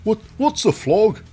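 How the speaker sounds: high, loud, baffled British caricature; mock British accent. Wrestlers puzzled by being called flogs, mock British accent. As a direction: put on a squawky, confused British voice. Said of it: "why they're called British now".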